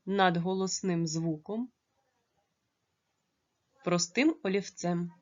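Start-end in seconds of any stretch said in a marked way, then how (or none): none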